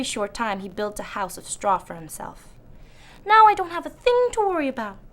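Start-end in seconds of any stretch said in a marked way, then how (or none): 3.2-5.0 high, pleased little pig voice; proud piglet. Acted. Straw pig brags safely inside his house, high and proud. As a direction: boast like a smug piglet. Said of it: "he said proudly".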